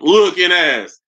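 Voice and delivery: ordinary delivery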